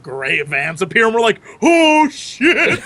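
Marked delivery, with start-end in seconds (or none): 1.6-2.8 startled shout. Retold or imitated. Startled by gray vans.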